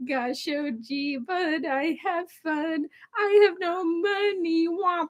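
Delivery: put-on gee-whiz little girl, wistful; Shirley Temple voice. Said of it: "dress up like old Shirley Temples".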